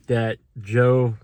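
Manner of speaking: ordinary talk, nothing marked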